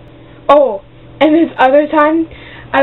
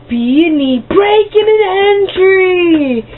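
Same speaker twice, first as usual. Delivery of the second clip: high, slow crying yell on breaking an entry; mock wail; 7 semitones higher, much slower than usual. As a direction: wail it like a fake alarm cry. Calling out locked out.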